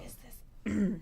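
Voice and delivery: sexy voice. Pretend. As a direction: try to sound seductive. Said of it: "Should I do sexy voice".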